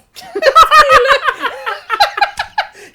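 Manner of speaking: sudden high-pitched burst of laughter; loud high laugh. Real, not acted. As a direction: crack up loudly. Burst of laughter mid-question. (gros éclat de rire aigu)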